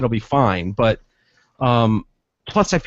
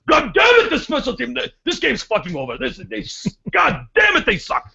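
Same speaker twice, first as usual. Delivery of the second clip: cursing fan impression; 8 semitones higher than usual. Fan curses at the Lions on TV. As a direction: rant at the TV like a bitter football dad. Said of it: "here's what God damn it will do".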